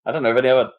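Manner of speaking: ordinary talk, nothing marked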